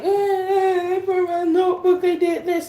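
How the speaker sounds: high-pitched tearful whine, imitated; mock crying. Girl tattling to mama about siblings, imitated crying. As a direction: blubber a complaint like a little kid. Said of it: "I start crying and I try telling my mama".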